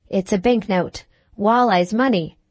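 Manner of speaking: ordinary delivery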